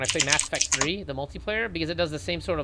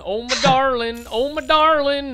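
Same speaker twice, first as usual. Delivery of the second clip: louder, higher, slower lovesick cry; mock swoon building; 8 semitones higher, 8 dB louder, slower than usual. Crying out to his co-caster, hammed-up lovesick and louder. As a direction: belt the endearment like a soap opera.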